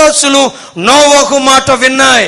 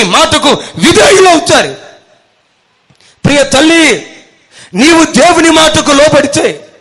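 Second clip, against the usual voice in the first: loud, high-pitched preaching; preaching at full force; 4 semitones higher, 4 dB louder than usual. Acted. Preacher blesses the congregation, preaching loud and high. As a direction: proclaim it to the whole hall.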